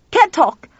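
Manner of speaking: loud high shout. Acted. Tuffy shouting on the stairs, loud. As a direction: fling it as you run. Hangs up on screaming caller.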